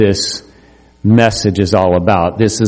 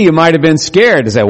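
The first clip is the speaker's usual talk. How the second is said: ordinary talk, nothing marked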